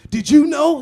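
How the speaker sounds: high loud mock gasp of a question; loud teasing did-you-know; mock surprise. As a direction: ham up the shocked politician. Mock politician asking crowd.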